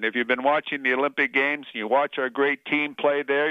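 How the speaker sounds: normal talking voice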